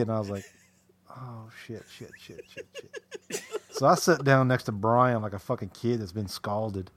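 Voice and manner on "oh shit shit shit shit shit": much softer, unvoiced panicked swearing; quietly terrified in a tough bar; scared muttering. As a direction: whisper it under your breath in fright. Narrator's scared reaction.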